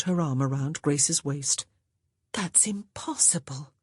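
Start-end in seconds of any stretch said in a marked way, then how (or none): 2.3-3.7 hushed, breathy reassurance; whispering. Acted. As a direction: whisper it softly to her sister. Sister comforting in hushed voice.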